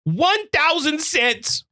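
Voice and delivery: high, slow mock-outraged whine; milk the thousand cents like a huge loss; mock outrage. Outraged mock complaint about price.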